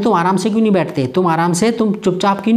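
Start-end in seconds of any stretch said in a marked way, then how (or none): none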